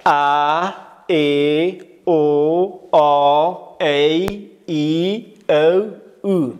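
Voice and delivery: slow loud drill "ا، ای، او، آ، ای، ای، او، او" as a, e, o, aw, ey, ee, ow, oo; vowel recitation. Shown as a demonstration. Teacher leads everyone together, reciting "ا" to "او" slowly and loudly. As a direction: sound out each vowel long and separate, from "ا" to "او".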